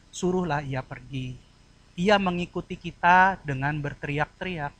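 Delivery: request it humbly, without force; pleading request. Shown as a demonstration. Preacher showing the disciples were begging, gentle plea. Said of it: "Kalimat yang satu memohon".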